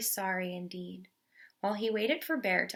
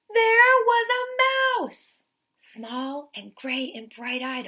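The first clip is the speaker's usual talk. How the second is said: much higher, louder, slow astonished voice; astonished reveal; 7 semitones higher, 8 dB louder, slower than usual. A narrator's reading. Teacher revealing the mouse a third time, astonished. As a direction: stretch the line out in shock.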